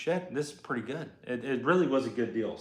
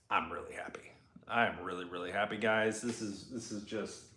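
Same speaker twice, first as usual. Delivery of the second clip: hushed happy; 4 semitones lower, 9 dB quieter than usual. Real, not acted. Excited about truck kit.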